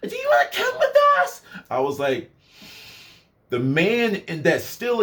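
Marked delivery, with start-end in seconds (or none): 0.1-1.4 put-on high excited girly squeal; squealing party girl. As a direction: invite him like a tipsy party girl. Imitating drunk girls inviting.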